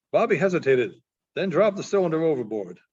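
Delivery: usual speaking voice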